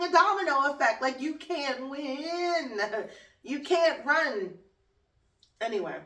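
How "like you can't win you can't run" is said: drawn out and higher, like a taunt; slow ominous warning. Pretend. Warning Donnie in a slow ominous taunt. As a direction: spell doom slowly.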